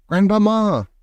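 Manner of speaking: high-pitched call, held back in volume; shouted. A narrator's reading. Grandson calls to grandmother through the door, high shout. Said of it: "I shouted as loudly as I possibly could".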